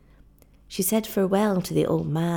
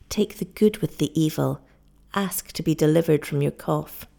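plain reading voice

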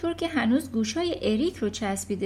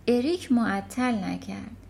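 usual speaking voice

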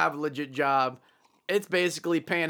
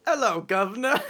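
a hammed-up Cockney greeting, higher; bad British accent; 6 semitones higher, 3 dB louder than usual. Asked for a British accent, bad Cockney greeting. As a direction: greet like a cartoon London cabbie. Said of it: "give me your best British accent right now".

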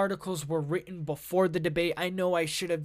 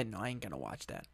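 much softer, fast, lower aside; quiet aside; 11 semitones lower, 16 dB quieter, faster than usual. Real, not acted. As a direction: mutter it to yourself. Aside refusing to watch video.

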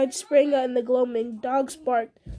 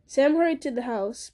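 ordinary delivery, nothing marked

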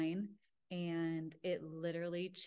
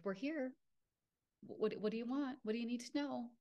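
imitated inner voice, pitched higher; different octave; 6 semitones higher, faster than usual. Spirit guides answering her call, in a higher octave. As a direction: put on a higher version of your own voice. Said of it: "like, a different octave".

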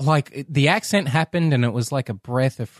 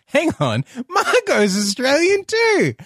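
delighted realisation; 11 semitones higher than usual. Acted. Audience member realises Margot is Australian, mock astonished. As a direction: pitch leaping high on the discovery.

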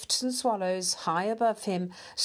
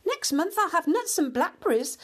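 high, lively mouse chatter; chattering country mouse; 9 semitones higher, 4 dB louder than usual. A narrator's reading. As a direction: chatter on eagerly, pitched high. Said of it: "the Country Mouse chattered on".

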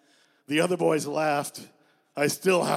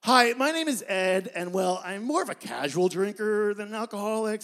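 higher, eager self-introduction; nervous introduction; 6 semitones higher, 3 dB louder than usual. Acted. Ed introduces himself to the AA group, higher and eager. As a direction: introduce yourself too brightly.

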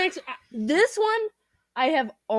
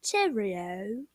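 mock British accent; 3 dB quieter, slower than usual. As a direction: playfully parrot a British catchphrase. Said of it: "British accent".

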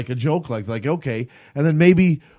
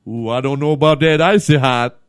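mock ad voice; 5 dB louder than usual. Joking Shaq ad voice.

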